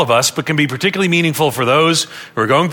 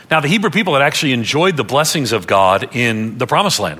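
normal talking voice

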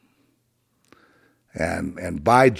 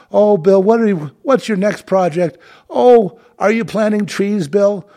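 high, loud gushing sycophant voice; hammed-up adoring admirer, much higher; mock fawning fan; 10 semitones higher, 7 dB louder than usual. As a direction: gush like a starstruck talk-show fan. Mocking fawning TV audience.

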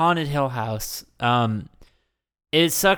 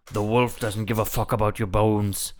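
Liam Neeson voice; 4 semitones lower than usual. Retold or imitated. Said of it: "I'm finding the voice".

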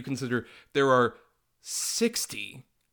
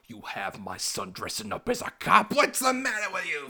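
higher, hammed-up New York Italian accent yelling; mock Italian-American mobster; 8 semitones higher than usual. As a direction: overblown mob dad scolding in an Italian-American accent. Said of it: "he's just like".

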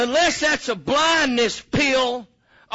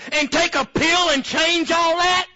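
ordinary delivery

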